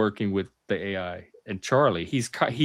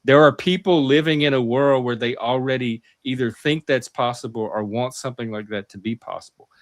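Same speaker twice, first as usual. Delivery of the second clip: ordinary talk, nothing marked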